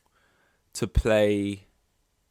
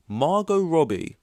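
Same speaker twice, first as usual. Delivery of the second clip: incredulous; 9 semitones higher, 6 dB louder, slower than usual. Real, not acted. Incredulous repeat of casting.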